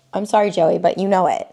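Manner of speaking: ordinary delivery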